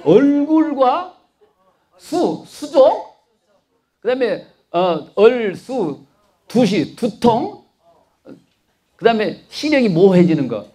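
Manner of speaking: teacher drawing out each word of the memory aid; slow drilled mnemonic. Shown as a demonstration. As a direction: spell out the mnemonic very slowly for the class. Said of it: "얼쑤 두 시는 잊어버리면 안 돼요".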